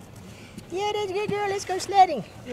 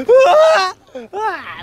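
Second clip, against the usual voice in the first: mock scared yelp; 5 semitones higher, 8 dB louder than usual. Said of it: "like she's like scared".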